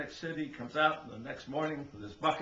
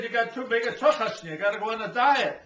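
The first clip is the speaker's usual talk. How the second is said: punched out loud and high; loud punchline; 8 semitones higher, 10 dB louder than usual. Acted. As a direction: belt the punchline for the laugh. Doctor's punchline over the phone.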